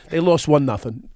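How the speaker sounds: ordinary talk, nothing marked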